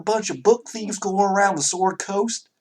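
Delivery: high, drawn Southern-gentleman voice; Southern character, indignant. Acted. Townsman hearing of book thieves, indignant Southern voice. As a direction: play an affronted Southern townsman, pitched high.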